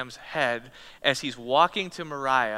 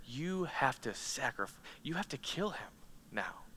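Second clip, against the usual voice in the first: quiet command; 12 dB quieter than usual. Acted. Preacher voices God's command.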